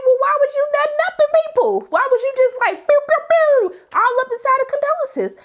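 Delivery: high, fast incredulous rant with "pew, pew"; exasperated high-pitched mock scolding; mocking outrage. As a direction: ridicule him in a squeaky outraged voice. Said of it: "I'm just like".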